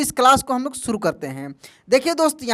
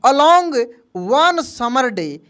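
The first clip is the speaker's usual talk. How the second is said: slow dictation; much slower than usual. Shown as a demonstration. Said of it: "dictating slowly".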